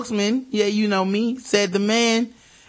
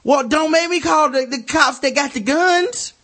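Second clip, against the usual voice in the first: mock polite cop; 7 semitones higher than usual. Host imitates an unarmed London officer, mild and high. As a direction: play a gentle unarmed bobby. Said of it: "they're like".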